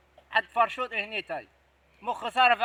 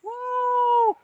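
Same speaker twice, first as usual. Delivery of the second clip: high drawn-out hooting call; owl hoot call; 12 semitones higher, much slower than usual. Acted. Signaling his partner with a high hoot. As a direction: hoot like a night bird to signal.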